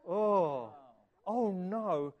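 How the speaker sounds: loud, drawn-out groan of dismay; mock dismay. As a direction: cry "oh no" in playful horror. Reacting to the first walk picture.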